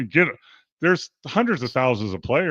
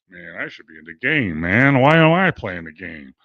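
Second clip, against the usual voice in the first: sulking benchwarmer; 7 semitones lower, 5 dB louder than usual. Retold or imitated. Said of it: "Are you back here just like".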